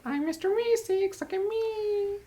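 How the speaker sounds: high, slow cartoon voice; Mr. Meeseeks voice. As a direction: chirp it like an eager cartoon helper. Correcting the Meeseeks impression.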